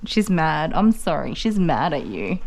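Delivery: normal talking voice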